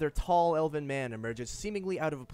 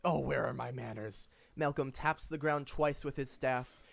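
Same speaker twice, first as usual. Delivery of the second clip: usual speaking voice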